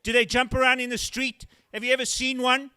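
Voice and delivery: wide-eyed excited questions. Retold or imitated. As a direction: gush like an overexcited fan. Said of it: "equally excited questions".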